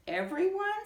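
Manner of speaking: high, rising astonished question; a squeaky incredulous everyone; surprised. Acted. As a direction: gasp it out in disbelief. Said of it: "Stinky asked".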